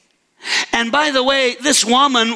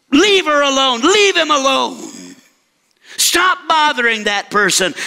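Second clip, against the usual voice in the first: firm rebuke; 3 semitones higher than usual. Acted. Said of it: "the Holy Spirit would say to us".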